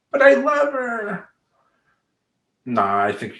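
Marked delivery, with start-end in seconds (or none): 0.1-1.3 high, drawn-out mock whine; whining protest. Client resisting coach's moratorium on women, whining. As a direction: imitate a lovesick man protesting, pitched far up.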